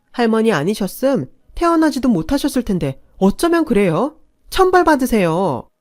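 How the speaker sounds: 분노에 찬 외침; angry outburst. Acted. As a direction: scold the elders with mounting rage. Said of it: "화를 냈고".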